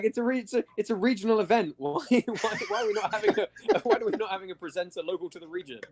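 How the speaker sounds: high-pitched mock indignation, laughing; whiny send-up breaking into laughter; mock complaint. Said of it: "you're like".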